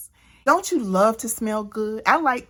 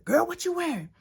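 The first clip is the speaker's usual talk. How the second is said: a touch of a higher, sassy gal-pal voice; sassy girlfriend; 7 semitones higher, 4 dB quieter, faster than usual. Retold or imitated. Women greeting each other, lightly voiced sassy girlfriend. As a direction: tease like a girlfriend eyeing an outfit. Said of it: "first thing that women love to say".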